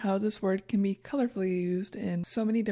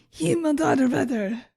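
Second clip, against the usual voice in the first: loud, higher German curse; mock frustration; 4 semitones higher, 5 dB louder than usual. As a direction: exclaim it as a playful frustrated outburst. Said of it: "how I'm going to pronounce my frustration".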